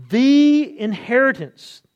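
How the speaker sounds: "The" as a long, loud, high "thee"; stress on The. Shown as a demonstration. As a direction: punch and stretch "The" before "inheritance". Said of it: "I emphasize 'cause the article is there".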